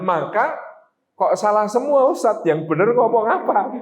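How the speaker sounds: ordinary delivery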